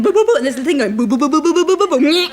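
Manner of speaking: high, loud, quick boo boo buzzer; fast high buzzing boos; mock buzzer noise. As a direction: imitate the countdown buzzer. Imitating game show buzzer.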